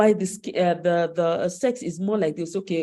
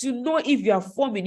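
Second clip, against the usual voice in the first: usual speaking voice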